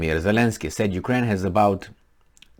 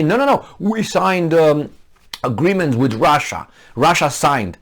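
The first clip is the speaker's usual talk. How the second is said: mock quote; 5 semitones higher, 4 dB louder than usual. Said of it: "And now they say".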